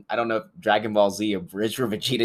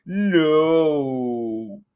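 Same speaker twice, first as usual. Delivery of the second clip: a flat villain refusal, louder; Steppenwolf voice; 6 semitones higher, 6 dB louder than usual. Retold or imitated. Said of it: "Steppenwolf's like".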